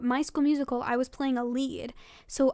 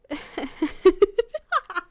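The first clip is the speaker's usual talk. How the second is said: high giggle; 5 semitones higher, 7 dB louder than usual. Real, not acted. Laugh after reading.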